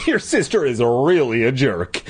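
ordinary delivery, nothing marked

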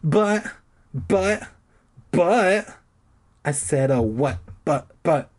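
stammering high panicked buts; sputtering. Acted. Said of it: "I sputtered".

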